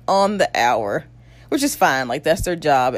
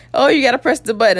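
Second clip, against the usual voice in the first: higher, hurried mock prompting; lightly voiced doctor, a quicker higher pitch; retold doctor; 6 semitones higher, 4 dB louder, faster than usual. Doctor telling her about the epidural button, mock prompting.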